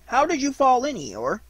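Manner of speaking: high, loud character question; high-voiced, busy questioning; inquiring Rabbit. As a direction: ask it briskly, pitched high.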